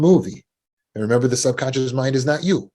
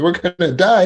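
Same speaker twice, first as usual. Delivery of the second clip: high, loud, hammed-up alarm; mock panic; 9 semitones higher, 6 dB louder than usual. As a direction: play the brain shrieking in fake terror. Voicing subconscious mind.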